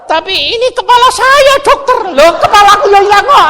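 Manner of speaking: high, loud frightened protest; panicked protest. Retold or imitated. Boatman protests to the doctor, high and loud panicked. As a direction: wail that your head's at stake.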